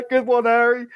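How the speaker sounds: high, guffawing troll voice; laughing troll. Acted. Marv falling backwards laughing at the other troll's throw, guffawing. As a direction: laugh it up, then praise your mate. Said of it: "roll on the floor and laugh at you".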